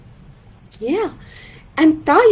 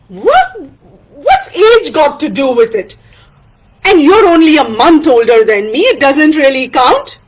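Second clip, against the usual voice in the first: loud, quick argumentative friend; acted exasperated comeback; Tana, indignant retort; 5 dB louder than usual. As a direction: snap back at the excuse.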